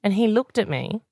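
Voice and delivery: ordinary talk, nothing marked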